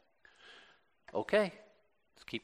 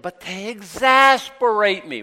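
louder, drawn-out mock complaint; a slow, put-upon groan of a line; mock exasperation; 8 semitones higher, 10 dB louder than usual. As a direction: whine it like a fed-up churchgoer. Listener's complaint voiced.

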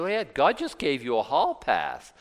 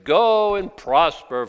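slow, louder mock blessing; slow, sneering go and prosper; sarcastic; 4 semitones higher, 6 dB louder, slower than usual. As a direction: drip sarcasm on every word. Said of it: "Now, factor in the sarcasm".

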